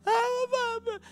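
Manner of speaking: put-on very high little-girl wail; mock hysterical crying. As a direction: imitate a granddaughter's hysterical cry. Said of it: "she starts crying hysterical".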